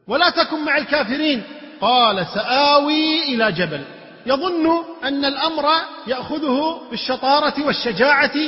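impassioned preaching. Acted. Preacher challenging listeners about who is saved, impassioned. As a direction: press the argument forcefully on the listeners.

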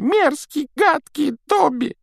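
high, loud self-scolding cry; shouting. A narrator's reading. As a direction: shriek it at yourself. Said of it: "кричал он при этом".

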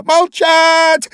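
very high, loud, furious yell; queen roaring at Alice, purple with anger; furious scream. A narrator's reading. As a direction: roar with rage. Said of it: "заорала королева, побагровев от гнева".

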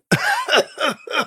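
harsh high strained cough; hacking cough. Acted. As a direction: cough hard and rough. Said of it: "раздался надсадный кашель".

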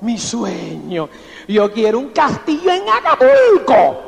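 low, drawn-out sigh swelling into a boast; dreamy upline. Retold or imitated. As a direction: ham up the overambitious upline's fantasy. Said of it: "me decía".

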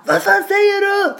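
hammed-up outraged bully; mock furious shout. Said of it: "Så de bara".

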